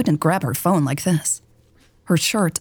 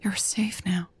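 soft, reassuring whisper; 9 dB quieter than usual. A narrator's reading. Mary holding Lex's face, reassuring whisper. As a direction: whisper it tenderly, much softer. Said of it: "she whispered".

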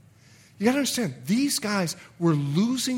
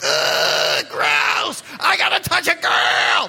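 grossed-out first grader; 2 semitones higher, 9 dB louder, slower than usual. Acted. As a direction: squeal it like a kid forced to hold hands. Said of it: "the boys and the girls are like".

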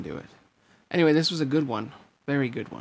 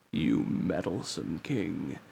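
slow, very low villain voice; Ansem; 7 semitones lower, 6 dB quieter, slower than usual. A narrator's reading. Villain cursing the meddlesome king, slow and low. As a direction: draw it out low, soft and menacing. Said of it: "Ansem's shout faded into the light".

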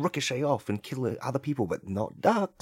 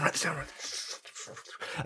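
hushed, softer repeat of write this down; whispered aside; 3 semitones higher, 7 dB quieter than usual. Real, not acted.

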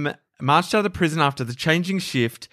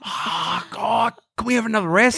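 high whiny plea for rest; high breathless whine; 6 semitones higher than usual. Retold or imitated. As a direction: complain like a puffed hiker. Mock puffed climber.

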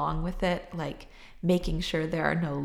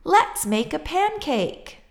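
excited shout; 9 semitones higher, 4 dB louder than usual. A narrator's reading. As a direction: call out proudly with the flour. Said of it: "shouted Jack".

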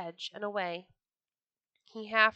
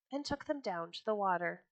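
ordinary delivery

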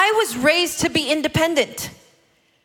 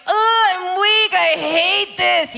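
drawn-out, higher mock wail of a little kid; hammed-up childish misery; imitated whining child; 4 semitones higher, slower than usual. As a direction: whine slowly like an overwhelmed five-year-old. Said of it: "she's just such a deep feeler".

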